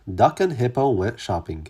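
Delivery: usual speaking voice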